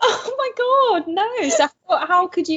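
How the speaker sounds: high, shocked disbelief. Real, not acted. Friend hears she cried drunk, high shocked disbelief.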